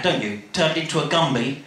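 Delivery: plain reading voice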